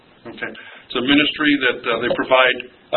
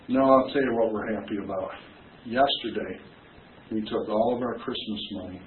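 quiet heartfelt quote; 4 semitones lower, 9 dB quieter than usual. Retold or imitated. Quoted parent softly testifying.

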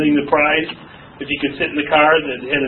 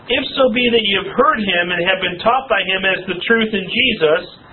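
ordinary talk

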